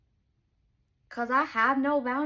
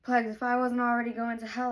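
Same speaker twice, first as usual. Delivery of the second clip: usual speaking voice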